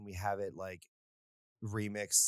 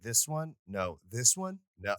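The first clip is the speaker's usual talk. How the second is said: Lori leafing through comics; 2 semitones higher, 4 dB louder, slower than usual. Retold or imitated. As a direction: act out a quick question-and-refusal exchange. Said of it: "and she's like".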